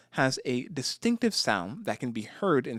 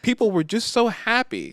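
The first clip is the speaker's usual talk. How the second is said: high, loud mock wonder; mock amazement; 10 semitones higher, 4 dB louder than usual. Real, not acted. Describing fans celebrating a win by rioting, high mock wonder. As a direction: sell the absurd joy, much higher. Mocking happy rioters.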